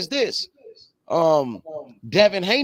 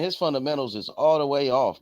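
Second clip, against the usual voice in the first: normal talking voice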